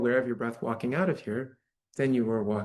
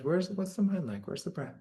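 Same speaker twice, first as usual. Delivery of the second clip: ordinary talk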